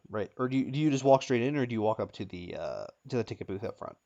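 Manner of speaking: normal talking voice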